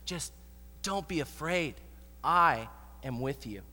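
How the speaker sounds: low, soft reassurance; calm someone who is scared, pausing between phrases; quiet, tender promise; comforting. Acted. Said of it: "Jesus tries to comfort them".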